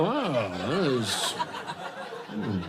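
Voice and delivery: slow, low, pondering art lover; mock intellectual. Comic imitates friend admiring a painting, mock intellectual awe. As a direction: drag it out as if deeply taking it in. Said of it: "Like he's an intellectual, like, taking it in".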